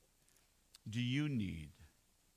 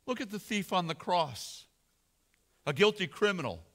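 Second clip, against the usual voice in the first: ordinary talk, nothing marked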